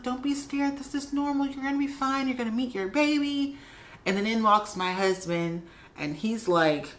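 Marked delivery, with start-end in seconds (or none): 0.0-3.6 high, sing-song soothing; reassuring nurse. Retold or imitated. Nurse reassuring a scared mother-to-be, super sweet. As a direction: comfort sweetly in a much higher voice. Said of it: "She was like".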